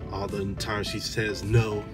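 ordinary talk, nothing marked

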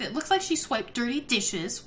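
ordinary delivery

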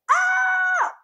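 very high, loud delighted scream; joyful scream. Real, not acted. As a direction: shriek with glee. Joy at trending.

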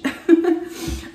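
breathy burst of laughter. Real, not acted. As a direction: laugh at yourself. Laughing at her big head.

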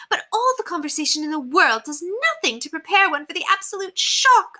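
high, theatrical society-lady voice; haughty lady, appalled. A narrator's reading. Lady telling governess of shocking paupers, haughtily appalled. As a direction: dwell on the horror in a high, affected voice. Narrator plays Lady Constance.

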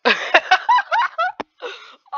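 high, loud burst of laughter. Real, not acted. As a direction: cracks up laughing hard. Laughing at angry player.

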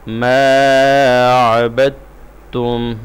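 ما عبدتم with ب joined straight into تم, no د; joined into the shadda. Shown as a demonstration. Teacher showing the sukun skipped. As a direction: run ب directly into the doubled ت. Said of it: "directly تشدید کے ساتھ ملاتے ہیں".

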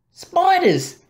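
high excited cry; eureka. A narrator's reading. Boy realising spiders will eat the fly, excited. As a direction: burst out with the idea.

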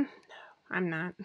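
ordinary talk, nothing marked

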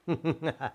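brief, quiet chuckle; soft laugh. Acted. Daughter chuckling after teasing her mother, quiet laugh. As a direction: laugh lightly at your own joke. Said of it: "అని నవ్వింది ఆర్నవి".

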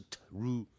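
"true" broken into "tuh-roo"; wrong pronunciation. Shown as a demonstration. Teacher repeating the mistake for students, split mispronunciation. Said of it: "不是".

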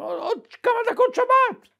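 excited reply. Retold or imitated. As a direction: blurt it out, up high.

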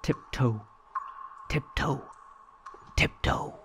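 soft, whispery tiptoe; hushed tiptoe. A narrator's reading. Reading to a child, hushed tiptoeing into the cave. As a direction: say it like sneaking past.

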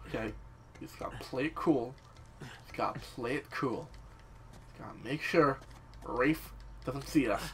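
low, soft muttering while sneaking; hushed self-talk. Real, not acted. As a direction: coach yourself quietly to stay unnoticed. Talking himself through sneaking past Rafe.

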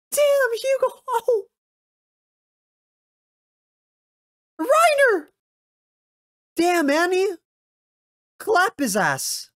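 very high, loud cheering shouts; hyped yelling. Real, not acted. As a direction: scream at the screen like a fight fan. Cheering fight scene.

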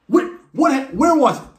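high, loud demanding dad; angry dad. Retold or imitated. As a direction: bark the question at the kid. Dad questioning son.

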